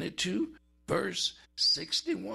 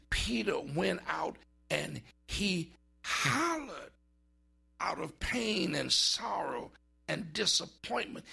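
ordinary talk, nothing marked

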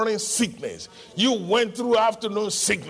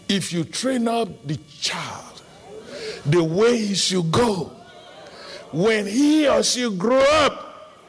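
from scripture reading up to a loud call, rising in pitch; building preaching; 3 semitones higher, 3 dB louder than usual. Acted. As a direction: climb toward a charged cry of faith. Quotes scripture, rising to exhortation.

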